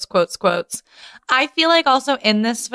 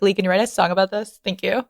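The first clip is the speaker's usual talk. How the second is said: fast request; faster than usual. Retold or imitated. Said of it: "I'm like".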